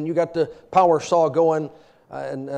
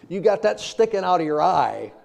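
ordinary talk

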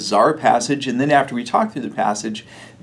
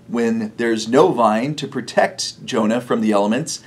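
normal talking voice

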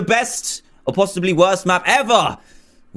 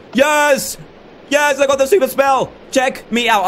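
excited cheer; 10 semitones higher than usual. Real, not acted. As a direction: celebrate like you just won. Gets the super spell.

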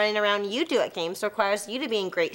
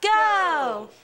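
cheer call; 6 semitones higher, 3 dB louder than usual. Real, not acted. Leading a crowd cheer.

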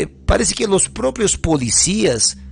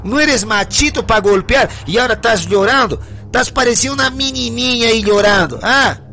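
mocking taunt; 4 semitones higher than usual. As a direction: ridicule him as a coward. Prisoner mocks crying man.

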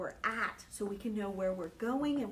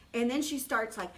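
ordinary delivery

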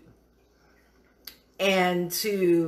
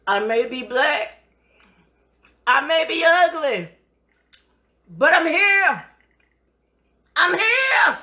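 builds from firm to a much higher, louder crying shout; defiant declaration; 8 semitones higher, 8 dB louder than usual. Acted. Quoting a defiant film line to celebrate her dish, rising to a crying shout. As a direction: start proud, end shouting through tears.

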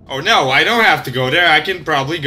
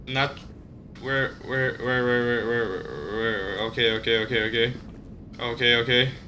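anxious muttering; 8 semitones lower, 12 dB quieter than usual. Real, not acted.

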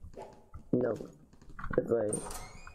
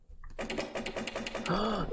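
gasp; 9 semitones higher, 4 dB quieter than usual. Real, not acted. Startled by a scare.

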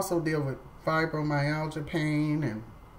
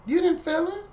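incredulous; 13 semitones higher than usual. Retold or imitated. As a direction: ask it in amazed disbelief.

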